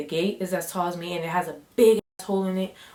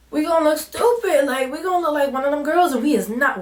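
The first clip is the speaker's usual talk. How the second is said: alarmed; 7 semitones higher, 5 dB louder than usual. Retold or imitated. Panicked at being filmed.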